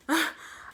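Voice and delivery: short burst of real laughter. Real, not acted. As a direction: laugh at your own story. Laughing at own story.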